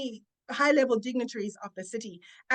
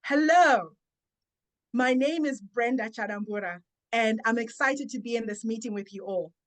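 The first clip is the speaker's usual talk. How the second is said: confident self-introduction. Shown as a demonstration. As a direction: say your name with confidence. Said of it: "you say something like this".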